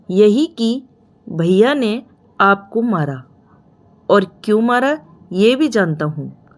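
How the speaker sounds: ordinary delivery, nothing marked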